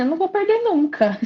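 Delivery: high-pitched retold despair; mock hopelessness. Client quoting her old belief about her belly, mock hopelessness. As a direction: pitch it up, playfully giving up before a laugh.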